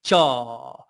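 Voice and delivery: jaw, vowel held long; drawn-out jaw. Shown as a demonstration. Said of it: "allow that word to elongate".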